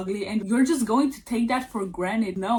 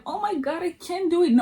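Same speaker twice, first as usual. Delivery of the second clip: higher pitched, mock despairing whine; mock panic; 8 semitones higher, faster than usual. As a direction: imitate your old panicked self giving up. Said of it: "I let go of these feelings like".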